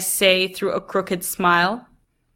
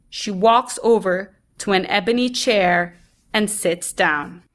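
ordinary delivery, nothing marked

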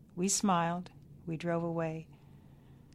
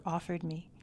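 plain reading voice

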